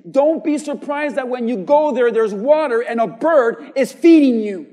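loud, rising sermon delivery with hammered words; preaching at full force. Acted. Preacher rousing the church about God's word, loud and fervent. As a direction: preach it, voice climbing and punching.

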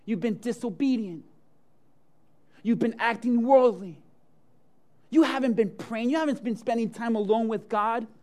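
forceful preaching. Acted. Preacher listing listeners' failures.

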